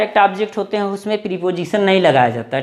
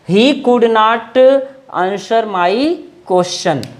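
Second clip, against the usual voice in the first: very slow, word by word; slow dictation; much slower than usual. Shown as a demonstration. Teacher dictating the sentence to students, very slowly. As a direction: spell it out slowly so students can write.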